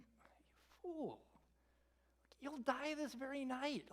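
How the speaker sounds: high and soft solemn rebuke; God telling the rich farmer he dies tonight, soft solemn rebuke. Acted. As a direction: pronounce judgment gently but gravely.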